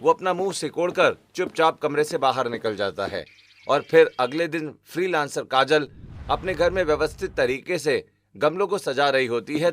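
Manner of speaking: plain reading voice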